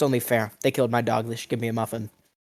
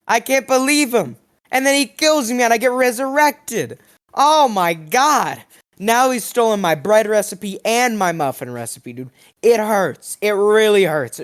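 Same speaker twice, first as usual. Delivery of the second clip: much higher and louder, hammed-up distress; mock anguish; 8 semitones higher, 4 dB louder than usual. Complaining to viewers that Newton stole his recipes, mock anguish. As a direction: overwrought whining complaint.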